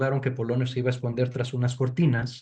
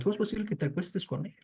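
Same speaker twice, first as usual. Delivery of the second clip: erotic reproach; 6 semitones higher, 8 dB quieter, faster than usual. Retold or imitated. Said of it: "es un reclamo erótico por parte de Hamlet".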